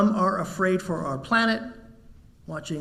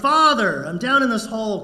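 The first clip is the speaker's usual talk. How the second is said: loud, higher call to a priest; shouting up; 4 semitones higher, 6 dB louder than usual. Acted. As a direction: call up out of the hole, loudest on the first word. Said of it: "the guy shouts up".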